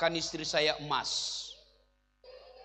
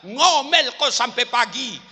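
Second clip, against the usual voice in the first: gloating husband; 4 semitones higher, 6 dB louder than usual. Retold or imitated. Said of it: "dia dorong istrinya".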